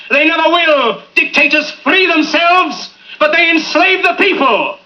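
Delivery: building to a soaring shout; impassioned speech. Acted. Speech builds to rousing climax.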